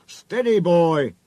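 loud, high warning call. A narrator's reading. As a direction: call out to check the engine. Driver calls to check engine.

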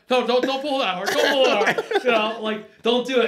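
urgent warning. Retold or imitated. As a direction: plead with him not to pull hard.

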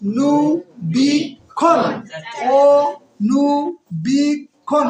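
"অনুবীক্ষণ" very slow, four separated beats; syllable by syllable. Shown as a demonstration. Teacher counting with students, "অনুবীক্ষণ" chopped into four syllables. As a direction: break "অনুবীক্ষণ" into অ, নু, বীক, খন with long gaps. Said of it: "কয়টা হলো".

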